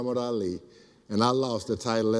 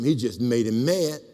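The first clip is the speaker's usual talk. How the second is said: ordinary talk, nothing marked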